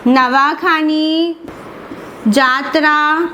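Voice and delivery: each syllable drawn out for notes; slow dictation. Shown as a demonstration. Teacher dictating festival names to students, slow and deliberate. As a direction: say it slowly so students can write it.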